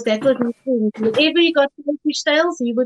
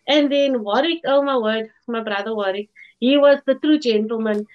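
normal talking voice

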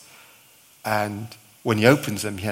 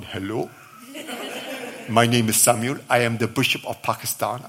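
put-on Pakistani bishop's accent. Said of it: "that's the best voice I've ever heard you put on".

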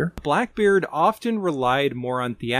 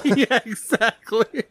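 laughing; 10 semitones higher, slower than usual. Real, not acted. Laughing agreement.

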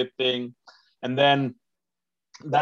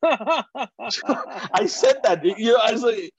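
high, laughing through the words; 9 semitones higher, much slower than usual. Real, not acted. Comedian owns up to his dark reply, laughing. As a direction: talk through a real laugh.

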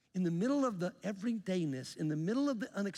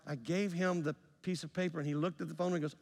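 ordinary delivery

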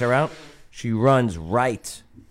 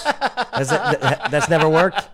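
laughing mockery; 7 semitones higher than usual. Mocking the arrestee's plea.

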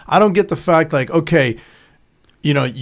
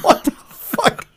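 laughing disbelief; 13 semitones higher, much slower than usual. Retold or imitated. Retelling friend in his driveway.

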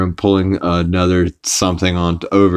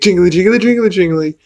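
high, sing-song jingly jingly; jingling keys for a baby; 11 semitones higher than usual. Retold or imitated. Quagmire entertaining the kids, high sing-song coo. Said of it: "he's like".

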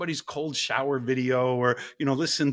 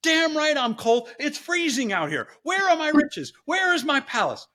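hammed-up indignant stepdaughter; spoiled daughter complaining; 11 semitones higher than usual. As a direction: demand your riches like an entitled brat. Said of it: "And she says".

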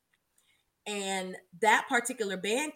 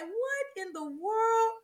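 amazed; 12 semitones higher, slower than usual. Retold or imitated. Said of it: "I was like".

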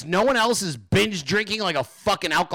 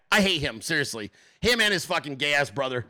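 ordinary delivery

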